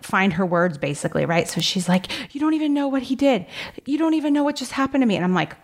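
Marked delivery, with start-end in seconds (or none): 2.3-5.1 higher, rushed retelling of a frantic caller; frantic mom. Retold or imitated. Mom calling daughter after being robbed, imitated as frantic. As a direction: blurt it fast and high, imitating a flustered mom. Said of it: "speechless and frenetic".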